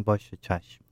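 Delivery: low, soft obedient reply; meek compliance. Retold or imitated. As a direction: give in quietly, imitating an obedient child. Child obeys after being hushed.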